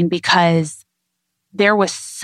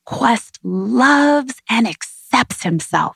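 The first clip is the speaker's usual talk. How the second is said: put-on higher, slow, sing-song like reading to a kid; excited storybook voice; 5 semitones higher, much slower than usual. Shown as a demonstration. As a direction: lift every word with excitement, slowly. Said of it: "with that inflection in your voice, with that excitement".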